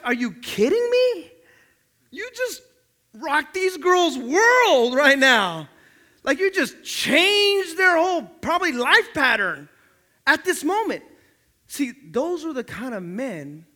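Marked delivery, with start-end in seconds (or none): 0.0-11.0 high, loud, drawn-out disbelief; incredulous outburst. Acted. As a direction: stretch the key words in astonishment. Acting out his amazed reaction.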